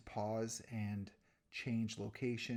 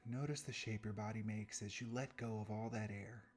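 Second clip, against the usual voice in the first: guided meditation. Real, not acted. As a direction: guide them unhurriedly through each breath, softening at phrase ends.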